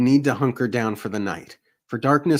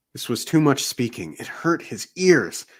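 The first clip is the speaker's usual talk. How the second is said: usual speaking voice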